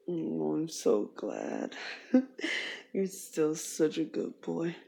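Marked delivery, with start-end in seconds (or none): 1.8-2.9 high, happy little giggle; delighted giggle. Acted. Girlfriend delighted by her partner, happy giggle.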